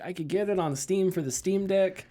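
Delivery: high-pitched torn weighing of options; Larry David. Retold or imitated. Host acts out a gif about choosing consoles, Larry David style. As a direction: lightly mimic a comic dilemma, voice lifted. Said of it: "that Larry David gif where it's like".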